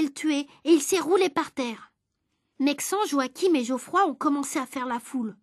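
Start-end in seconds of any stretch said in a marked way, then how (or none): none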